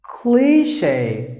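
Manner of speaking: cli-SHAY with a long drawn-out first vowel in Cliche; long sound. Shown as a demonstration. Teacher showing learners how to say Cliche, with a long sound. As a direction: stretch Cliche slowly, syllable by syllable. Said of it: "long sound".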